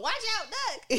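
playful call to take cover; mock warning shout. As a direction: sound the alarm, half joking. Warning about mom's mood swings.

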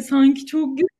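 mock catty remark, lightly voiced; snide put-down. Friend imitating the girl's nasty jab, mildly catty. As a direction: sneer it like a passing insult. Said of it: "Bir de kötü bir laf hani".

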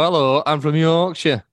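slow, drawn-out demo of a Yorkshire accent. Shown as a demonstration. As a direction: show off a broad Yorkshire accent. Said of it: "do a Yorkshire accent like that".

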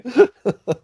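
high-pitched burst of laughter. Real, not acted. Laughing at the story.

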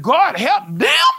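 slow, whining "help them?" to God; mock whiny protest. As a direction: drag it out in mock disbelief. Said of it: "You be like".

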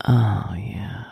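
low, whispered lover's reply; breathy whisper. Pretend. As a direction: whisper it as an imagined response. Imagines a lover's aroused response.

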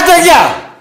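sudden high, very loud dismissal; angry shout. Real, not acted. Host cutting off a caller's question, angry shout. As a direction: shout them off the line.

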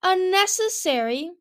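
high, loud, drawn-out protest; indignant roar. A narrator's reading. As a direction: shout it slowly in outrage. Darkstalker roars in protest.

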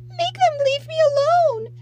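put-on very high, loud child's plea; sobbing wail. A narrator's reading. Little girl begging her father for protection, wailing. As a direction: wail it pleadingly. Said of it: "Heather sobbed".

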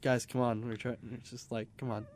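tired, softly pleading; weary, quiet. Retold or imitated. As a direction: sigh it out, worn down. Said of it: "Spike Bouncer is just like".

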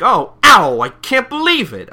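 high, loud whiny dude voice; silly comic voice. Retold or imitated. Recapper voicing a manga artist, loud whiny goofball. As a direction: ham up a goofy American guy. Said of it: "I can't wait to fucking do this voice".

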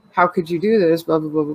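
usual speaking voice

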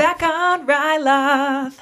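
slow, high, drawn-out echo; dramatic echo. Pretend. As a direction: stretch it out like a trailer voice. Echoing the episode intro.

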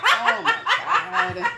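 high, slow, through laughter; wheezing with laughter; laughing hard. Real, not acted. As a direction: let the laugh carry the words. Both cracking up.

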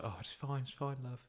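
soft, soothing retold comfort; gentle reassurance. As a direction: murmur it as if hugging someone upset. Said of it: "I just hugged her".

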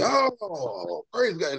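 high, softened cry of praise; elated. Retold or imitated. Reverend hearing he will write the foreword, imitated elation. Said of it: "he was just elated".

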